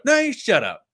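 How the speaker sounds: high, laughing mimic of an argument; playful imitation of a row overheard; mock background shout. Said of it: "I can hear the background".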